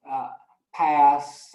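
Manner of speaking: normal talking voice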